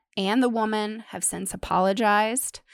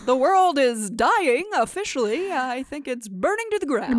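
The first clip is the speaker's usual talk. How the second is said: higher, put-on news anchor read; newscaster voice; 4 semitones higher than usual. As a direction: announce doom like evening news. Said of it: "I can still sound like a newscaster".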